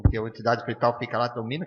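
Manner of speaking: ordinary delivery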